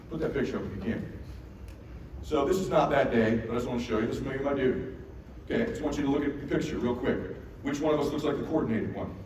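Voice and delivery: usual speaking voice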